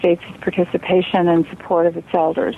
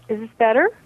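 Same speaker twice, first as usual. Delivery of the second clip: ordinary talk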